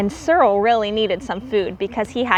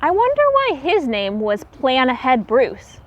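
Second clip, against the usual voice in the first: plain reading voice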